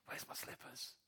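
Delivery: breathy, relaxed muttering; whispery slippers mutter; hushed homely mumble. Retold or imitated. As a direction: sink into home comfort, barely voiced. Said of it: "that feeling".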